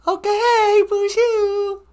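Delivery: very high, loud put-on greeting voice; high-pitched Natasha character. Natasha greeting the returning storyteller, high put-on character voice. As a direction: squeal a hello in falsetto.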